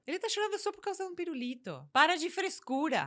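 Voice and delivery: imitating a parent who calls crying nonsense; mocking dismissive parent. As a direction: belittle the child's tears.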